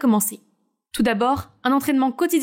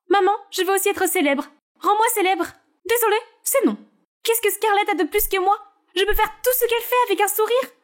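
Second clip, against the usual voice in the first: higher character voices, cool refusals; beg, then get shut down; pleading daughter and cold mother; 8 semitones higher than usual. Acted. Naomi begs her mother for fame, higher pleading voices.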